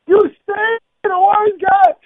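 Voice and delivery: high, loud yell from the crowd; a heckler shrieking across a rally; heckler's scream. Retold or imitated. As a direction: scream it out at the speaker.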